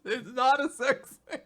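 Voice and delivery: high, laughing through the words; laughing repeat. Real, not acted. Co-host repeats the joke to his friend, laughing. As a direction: giggle it out.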